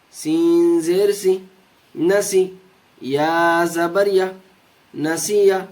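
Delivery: steady, measured recitation of each syllable; spelling drill. Shown as a demonstration. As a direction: lead the class through the spelling. Teacher drills syllable joining.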